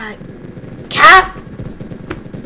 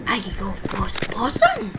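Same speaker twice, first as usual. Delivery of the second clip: whispered aside; 7 semitones lower, 12 dB quieter than usual. Real, not acted. Gamer quietly switching view. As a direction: murmur it to yourself.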